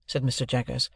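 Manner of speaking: plain reading voice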